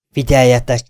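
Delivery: 1 loud call. A narrator's reading.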